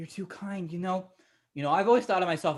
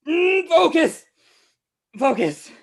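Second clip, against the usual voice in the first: loud, high snap to attention; straining shout through the pain; urgent self-command; 9 semitones higher, 7 dB louder, slower than usual. Acted. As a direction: force yourself to focus, loudly. Trying to rouse self and companion.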